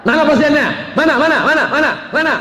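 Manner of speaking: high, excited asking over and over; eager calling out. Retold or imitated. Patients eagerly asking where the doctor is, high and excited.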